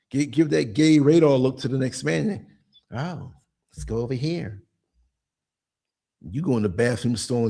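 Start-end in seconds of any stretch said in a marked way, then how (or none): 2.9-4.6 sly quiet invite. Retold or imitated. Voicing a man flirting.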